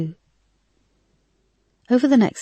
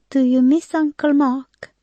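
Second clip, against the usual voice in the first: put-on high, light little boy's voice; child voice; 10 semitones higher, 4 dB louder than usual. A narrator's reading. Little nephew to his sad aunt, high child voice. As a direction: voice a small boy gently.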